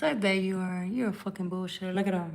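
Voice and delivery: usual speaking voice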